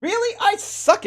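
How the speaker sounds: high, exasperated cry at a bad roll; frustrated outburst. Real, not acted. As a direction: groan it out in disbelief. Bad initiative roll at table.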